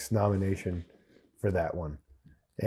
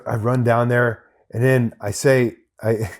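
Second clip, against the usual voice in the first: ordinary delivery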